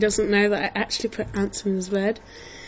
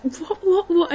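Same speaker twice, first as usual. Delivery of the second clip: much higher stunned "what"; shocked; 9 semitones higher than usual. Retold or imitated. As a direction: squeak out disbelief. Brother shocked at being dumped.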